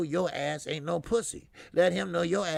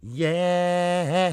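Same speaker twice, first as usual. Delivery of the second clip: loud, high, long held cry; catchphrase holler; 4 semitones higher, 8 dB louder than usual. Real, not acted. Host hyping a viewer shout-out, hollering. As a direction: hold the one word out like a hype call.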